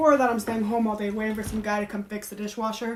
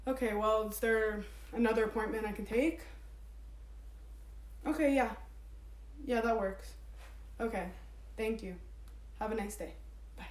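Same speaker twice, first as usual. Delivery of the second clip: calm polite; 11 dB quieter than usual. Real, not acted. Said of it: "Did you think I was gonna yell".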